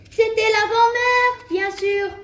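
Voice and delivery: high, loud, drawn-out announcement; exclaimed reveal. A narrator's reading. Narrator revealing who was in the wardrobe, exclaiming.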